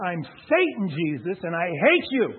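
loud, hammered-out declaration of hate; retold menacing shout, drawn out on the repeat; imitated shouting. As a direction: shout it in his face, punching the key words. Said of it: "he's shouting this in my face".

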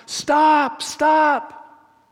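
high, loud shouted stop; yelling stop. Retold or imitated. Generals yelling at fleeing troops, loud shout. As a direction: shout after fleeing soldiers. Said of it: "are yelling".

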